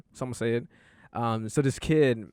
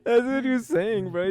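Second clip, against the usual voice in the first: high-pitched, cracking up; laughing; 10 semitones higher than usual. Real, not acted. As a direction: talk through real laughter. Still laughing at the story.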